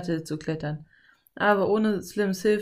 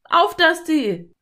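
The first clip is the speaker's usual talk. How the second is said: high, loud, drawn-out call to the horse; stretched rallying cry, pitched high; urgent shout; 10 semitones higher, 10 dB louder, slower than usual. A narrator's reading. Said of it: "rief er".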